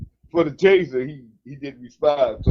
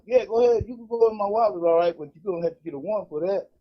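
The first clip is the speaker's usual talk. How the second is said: defiant retort; 7 semitones higher than usual. Retold or imitated. Defiant answer to cop.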